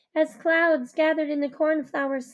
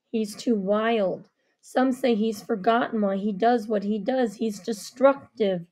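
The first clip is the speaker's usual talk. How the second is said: lightly lowered solemn man; low grave man; 6 semitones lower than usual. Acted. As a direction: weigh each word like a warning. Avery explaining Jack.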